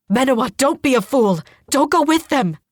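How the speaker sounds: loud, high frantic urging; urgent warning. A narrator's reading. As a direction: urge the sister loudly to stop. Brother begs sister not to go.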